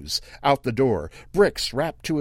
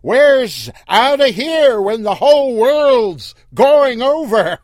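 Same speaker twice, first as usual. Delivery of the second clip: loud, slow, taunting high call; mocking yell; 13 semitones higher, 13 dB louder than usual. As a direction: yell it with a laugh at the end. Earl yelling, laughing.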